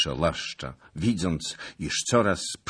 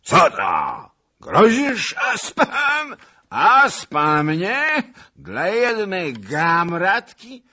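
put-on very high, loud, slow drunken rage; furious roar; 12 semitones higher, 9 dB louder, slower than usual. A narrator's reading. Furious drunk roars at lieutenant, high and loud. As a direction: bellow shaking with fury. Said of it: "huknął, trzęsąc się ze złości".

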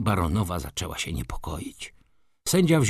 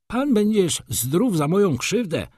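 high-pitched indignant snap; angry retort; 11 semitones higher, 4 dB louder than usual. A narrator's reading. Gossip woman answers her antagonist, high angry retort. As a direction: fire back angrily, pitched well up. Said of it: "odparła z gniewem kumoszka".

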